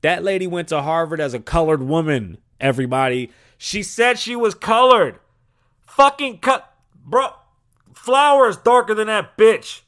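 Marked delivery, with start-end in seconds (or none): 3.6-9.8 high, loud, drawn-out incredulous yell; exasperated high-pitched hollering; outraged shouting. Acted. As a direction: shout it in comic outrage, stretching the words. Outraged rant about a false claim.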